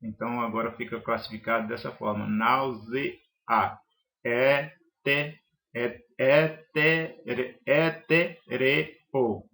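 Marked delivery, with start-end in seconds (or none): 2.3-3.2 "náusea" split into separate syllables, nau-ze-a. Shown as a demonstration. Teacher showing the syllable division, "náusea" said piece by piece. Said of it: "a divisão silábica dela". 4.2-9.4 word chopped into separate syllables, e-té-re-o. Shown as a demonstration. Teacher showing the syllable division, each syllable said separately. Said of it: "a divisão silábica dela".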